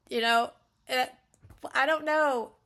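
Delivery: ordinary delivery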